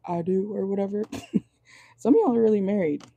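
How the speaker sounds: strained, cracking voice; losing her voice mid-sentence; voice going out. Real, not acted. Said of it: "My voice is going out".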